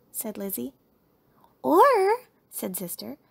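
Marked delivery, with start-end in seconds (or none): 1.6-2.3 high, loud, drawn-out cub; excited child's interjection; eager suggestion. A narrator's reading. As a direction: float an idea, pitched way up. Sister suggests a compromise.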